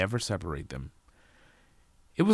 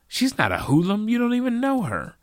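high, loud objection; indignant protest; 8 dB louder, faster than usual. A narrator's reading. Jacob defends Sally to his father, indignantly. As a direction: jump to her defence, raised voice.

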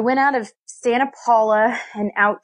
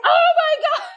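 much higher, startled mock gasp; imitating her own fright, pitched way up; retold jump-scare exclamation; shocked gasp. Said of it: "And I was like".

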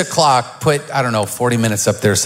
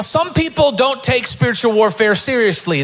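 normal talking voice

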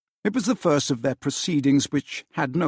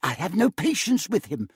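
impatient wife; 6 semitones higher than usual. A narrator's reading. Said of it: "observed Scrooge's niece".

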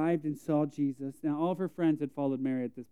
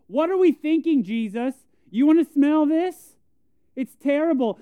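high, loud mock disgust at the idea; worldly scoffing; 12 semitones higher, 10 dB louder than usual. Martha scoffing at Jesus about the smell, high and loud. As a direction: scoff like nobody wants that smell. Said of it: "the worldly attitude of".

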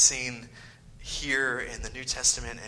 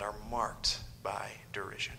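hushed whisper to drive the point home; dramatic whisper; 3 semitones lower, 9 dB quieter than usual. Real, not acted. Preacher to congregation, a hushed whisper. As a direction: let the line fall to a whisper.

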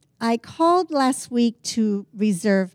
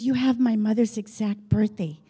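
ordinary delivery

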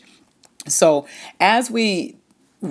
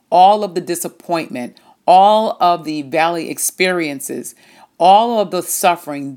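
rising louder repetition, stressing each "all"; preaching build; 4 dB louder than usual. Acted. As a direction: build the list like a preacher, hitting every "all".

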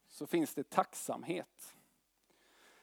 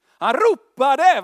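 loud, drawn-out, much higher cry; intense cry; 8 semitones higher, 15 dB louder, much slower than usual. Shown as a demonstration. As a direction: shout it with full intensity. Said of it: "Med intensitet". (ropar högt och intensivt)

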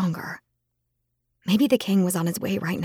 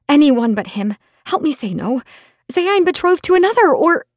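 much higher, louder desperate cry; frantic plea; 7 semitones higher, 5 dB louder than usual. A narrator's reading. Daughter frantically begging her mother for help. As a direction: rise into panic.

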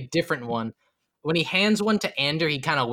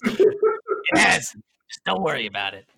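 laughing barkeep denial; slower than usual. Acted. Barkeep denying the roofie, laughing. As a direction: brush it off while laughing. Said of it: "He does".

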